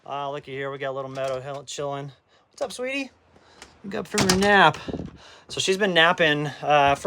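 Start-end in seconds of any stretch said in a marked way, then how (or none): 2.5-4.8 sweet puppy talk. Real, not acted. Owner greeting his puppy after her nap, high doting voice. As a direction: coo at the waking puppy.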